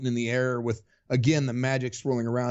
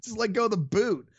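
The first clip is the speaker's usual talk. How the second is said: retold insistent order, pitched higher; insistent repeat; 8 semitones higher than usual. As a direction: push harder, she's losing patience. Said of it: "And she's like".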